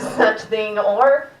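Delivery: normal talking voice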